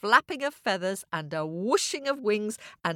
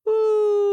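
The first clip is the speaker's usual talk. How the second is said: very high, loud oomph; comic oomph; 7 dB louder than usual. Acted. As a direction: land with a comic thud. Birds crash down.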